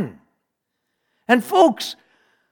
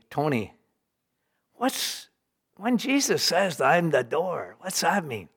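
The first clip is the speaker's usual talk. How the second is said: hushed question; 10 dB quieter than usual. Retold or imitated. Patient quietly asks about Jesus.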